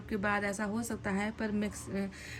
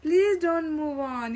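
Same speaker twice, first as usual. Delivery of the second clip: drawn-out, higher, louder plea; pleading; 5 semitones higher, 6 dB louder, much slower than usual. Acted. Reader voices the partner begging not to move on, pleading. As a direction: beg with long stretched words. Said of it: "ये कह रहे हैं कि".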